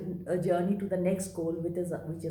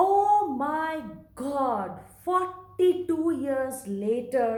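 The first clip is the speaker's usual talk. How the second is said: excited exclamation; 5 semitones higher, 4 dB louder, much slower than usual. Real, not acted. Finding lost childhood friend again.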